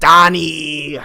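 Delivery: loud, drawn-out high holler; calling out. Acted. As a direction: holler for your brother in a mock impression. Calling out for brother.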